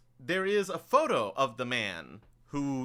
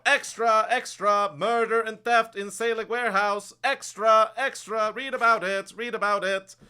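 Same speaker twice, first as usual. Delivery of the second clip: high, loud street-corner cry of a newspaper hawker; town crier; 11 semitones higher, 7 dB louder than usual. Acted. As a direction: belt out the headlines to the whole street. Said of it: "he'll turn it around and sort of go".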